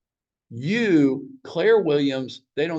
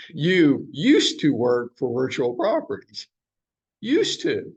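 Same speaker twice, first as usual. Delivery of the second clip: "used to" drawn out and leaned on; slow, pointed "used to"; stress on used to; slower than usual. Shown as a demonstration. Broker teasing the agent, leaning on "used to". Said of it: "Notice that I said "used to".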